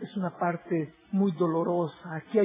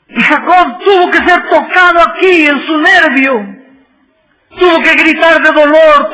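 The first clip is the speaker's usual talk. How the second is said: loud, high, ringing sermon delivery; impassioned pulpit cry; full-force preaching; 9 semitones higher, 15 dB louder than usual. Acted. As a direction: preach it out to the back pew. Preacher rising back to full force.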